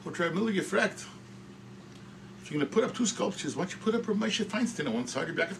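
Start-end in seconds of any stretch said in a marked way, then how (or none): none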